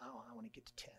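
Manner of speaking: soft whisper, barely voiced; whispered retold thought. Student whispering his private goal to double digits. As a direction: confess the old ambition in a whisper. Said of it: "But I said".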